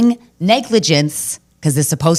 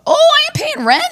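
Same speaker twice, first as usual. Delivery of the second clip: sudden squeaky high mock outrage; shrill outburst; 4 dB louder than usual. To the building managers, a hammed-up squeaky high rent strike cry. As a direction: shoot way up into a shriek of defiance.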